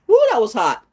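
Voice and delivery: high, loud whoop with a laugh; laughing reaction. Real, not acted. Eater reacting to hot wings, laughing loudly.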